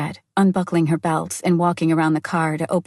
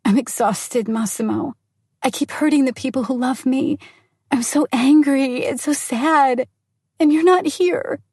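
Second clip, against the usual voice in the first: distraught; 7 semitones higher than usual. Acted. Distraught wife on phone to husband.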